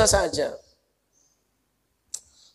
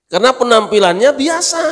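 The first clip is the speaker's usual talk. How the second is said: laughing through the words, higher and louder; 9 semitones higher, 4 dB louder than usual. Real, not acted. Preacher laughing while telling the congregation the point. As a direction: chuckle out the punchline.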